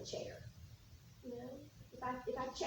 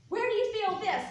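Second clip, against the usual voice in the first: projected, louder voice; talking loudly; 5 semitones higher, 9 dB louder than usual. Shown as a demonstration. Clinician demoing loud speech to patients, louder. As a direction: push it out loud so it reverberates. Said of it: "when you're talking loudly".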